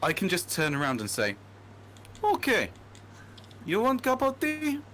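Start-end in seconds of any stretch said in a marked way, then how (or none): 2.2-4.8 put-on much higher, louder menacing Russian accent; looming villain-style Russian accent. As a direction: play a supervillain offering tea in Russian accent. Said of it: "a Russian accent in my place of work".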